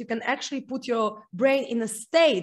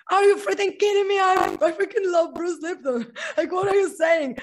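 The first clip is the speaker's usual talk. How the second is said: retold squeal of disbelief, higher and louder, laughing at the end; excited disbelief; 7 semitones higher, 4 dB louder than usual. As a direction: replay the moment you couldn't believe it. Retelling hearing Bruce Lipton's nephew.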